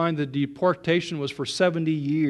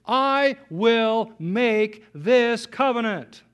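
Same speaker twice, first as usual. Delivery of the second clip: slow, "I" stressed at the start; slow emphatic "I will make"; 4 semitones higher, much slower than usual. Shown as a demonstration. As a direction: drive home "I" word by word. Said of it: "It's an emphatic, the point is".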